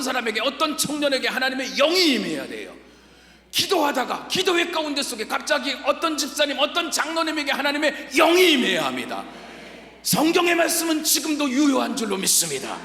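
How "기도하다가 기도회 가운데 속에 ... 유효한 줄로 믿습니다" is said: full force preaching. Acted. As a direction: preach it out over the music.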